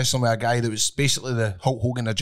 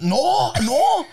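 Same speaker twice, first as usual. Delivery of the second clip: emphatic no; 13 semitones higher, 3 dB louder than usual. Retold or imitated. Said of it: "And I was like".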